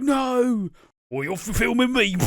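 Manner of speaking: put-on high, loud kid yelling back; upset kid. Kid shouting at his mum who films him, high and loud. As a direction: yell back like a cornered kid. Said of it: "And he's like".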